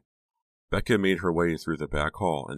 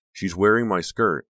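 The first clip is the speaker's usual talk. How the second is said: indignant; 4 semitones higher, 7 dB louder than usual. A narrator's reading. Disbelief turning to anger.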